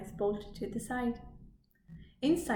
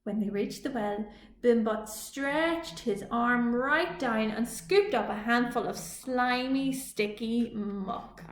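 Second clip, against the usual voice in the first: ordinary delivery, nothing marked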